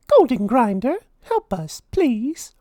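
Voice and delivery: high mouse voice chanting; Minnie's spell. A narrator's reading. Minnie calling on the grinder for food, high chant. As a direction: say the spell hopefully.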